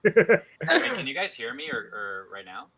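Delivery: distant, roomy sound from a far mic; speaking from across the room. Real, not acted. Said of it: "it sounds like you're far away".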